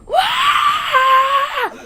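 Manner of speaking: long, very high loud wail; drawn-out mock scream. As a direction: imitate a kid screaming at the top of his lungs. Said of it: "he starts screaming, just like".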